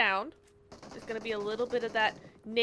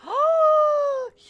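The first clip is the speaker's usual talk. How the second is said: high loud shocked gasp; dramatic gasp; 9 dB louder than usual. Pretend. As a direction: gasp in mock horror. Threat to clip and tag Max.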